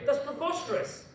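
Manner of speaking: high-pitched scoffing academic; Professor Atkins. Retold or imitated. Oxford professor answering the question why, mock indignation. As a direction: dismiss the question in a lofty, high voice. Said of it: "Professor Atkins of Oxford University".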